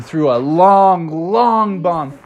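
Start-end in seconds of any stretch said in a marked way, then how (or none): none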